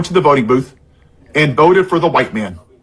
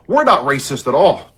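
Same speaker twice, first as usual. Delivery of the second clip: ordinary talk, nothing marked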